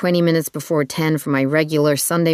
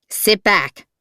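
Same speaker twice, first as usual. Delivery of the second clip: higher, firm order with an edge; edged command; 6 semitones higher than usual. A narrator's reading. Driver ordering his passenger back, edge in his tone. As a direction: shut the passenger down with authority. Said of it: "the way he said it, the tone, the edge".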